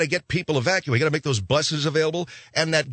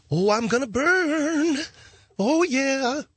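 high, slow hammed-up wail; mock confession panic; 12 semitones higher, much slower than usual. Phil tells the priest he will burn, high slow mock wail. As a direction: moan to the priest in comic dread.

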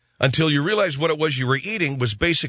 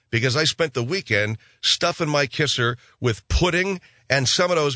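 ordinary talk, nothing marked

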